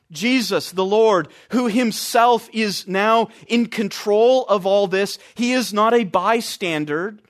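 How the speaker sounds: usual speaking voice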